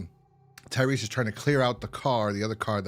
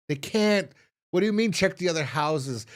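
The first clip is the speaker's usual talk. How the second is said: higher-pitched, disbelieving retort; mock-indignant objection, retold; incredulous protest; 6 semitones higher, 3 dB louder than usual. Rick objecting to checking the other houses, incredulous. Acted quote objecting to a suggestion.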